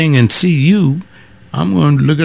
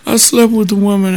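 high, halting confession, a touch louder; retold tearful confession; 10 semitones higher, 3 dB louder than usual. Crying church member confessing to his pastor, high and halting. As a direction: confess it in pieces like a man crying. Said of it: "and he was crying".

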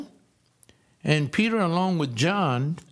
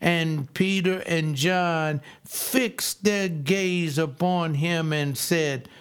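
usual speaking voice

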